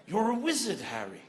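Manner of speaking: put-on British accent with a falling end. Comedian channels Harry Potter for his British accent, British accent. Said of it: "I channel Harry Potter".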